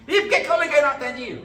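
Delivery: fast, loud, high complaint; indignant caller. Retold or imitated. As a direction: mimic an annoyed caller demanding why.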